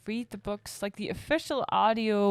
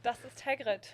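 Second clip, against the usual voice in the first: German-spoken Das ist Hagrid; German accent; 3 semitones higher, 6 dB quieter than usual. Pretend. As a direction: say it in plain German. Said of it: "with your German accent".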